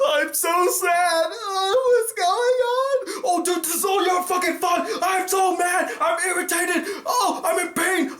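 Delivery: high, overwrought mock emoting, hammed-up; mocking bad actors. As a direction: parody a terrible actor wailing each feeling. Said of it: "they're trying so hard to convince us".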